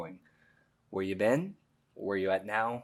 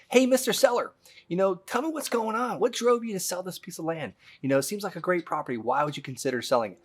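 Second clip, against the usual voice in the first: friendly agent pitch; 8 semitones higher than usual. Acted. Agent role-plays asking seller.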